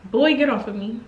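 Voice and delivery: put-on annoyed boy get off. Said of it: "And I'm just like".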